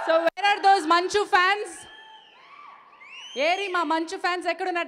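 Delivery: loud, high, slow call to the crowd. Real, not acted. Host calling the Manchu fans forward, loud and drawn out. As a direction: shout it out to the back rows.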